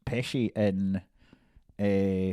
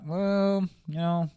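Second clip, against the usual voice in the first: mild De Niro impression; 5 semitones higher, 6 dB louder, faster than usual. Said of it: "De Niro's like".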